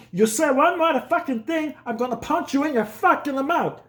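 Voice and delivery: high, loud mock threat rising to a yell; hammed-up furious dad; dad threatening his son in front of the neighbor. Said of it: "he looked at me and goes".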